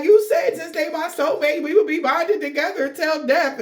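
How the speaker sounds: high, indignant mock lover; hammed-up betrayed soulmate; hurt lover quote. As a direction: squeak out the broken promise like a jilted partner. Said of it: "But they like".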